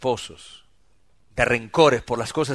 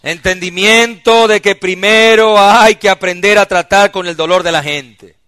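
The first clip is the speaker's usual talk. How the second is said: slow, much louder and higher preaching; full-force preaching; 7 semitones higher, 14 dB louder than usual. Real, not acted. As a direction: proclaim each point from the pulpit.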